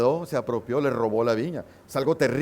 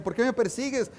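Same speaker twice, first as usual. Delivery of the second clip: confronting; 7 semitones higher than usual. Retold or imitated. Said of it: "lo confronta y le dice".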